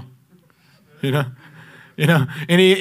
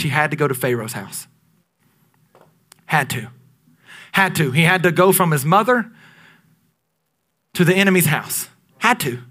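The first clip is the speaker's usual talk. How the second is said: a hushed pulpit refrain; preaching hush; 2 semitones lower than usual. Acted. Preacher drops to emphatic hush.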